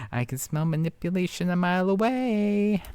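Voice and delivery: high, singsong mock suspicion; singsong taunt. Commentator calling out manipulation, high singsong taunt. Teasing accusation of playing sympathy card.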